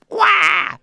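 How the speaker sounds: put-on high wailing baby cry. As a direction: wail like a newborn. Baby crying in skit.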